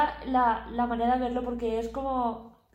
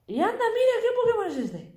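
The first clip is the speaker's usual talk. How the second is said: excited; 11 semitones higher, 5 dB louder than usual. Real, not acted. Spots a Pokémon on the map.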